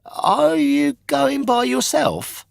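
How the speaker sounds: very high, bright schoolboy chatter; eager kid talk; high boyish voices. Acted. Boys talking about the trip.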